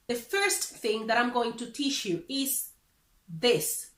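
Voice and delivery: usual speaking voice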